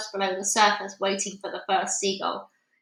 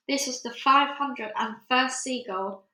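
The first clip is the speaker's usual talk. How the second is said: usual speaking voice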